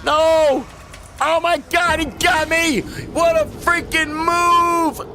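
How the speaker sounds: very high, loud outraged yells; shocked scream. Real, not acted. Player shouting after invader knocks him off the edge, shocked screams. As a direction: scream in disbelief as you get killed.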